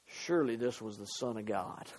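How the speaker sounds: very low, soft quoted voice; hushed realization. Retold or imitated. Preacher voices the centurion at the cross, hushed. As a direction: drop right down to a quiet, solemn statement.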